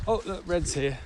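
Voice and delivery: ordinary talk, nothing marked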